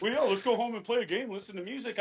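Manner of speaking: chipper wife. Retold or imitated. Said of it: "And she's like".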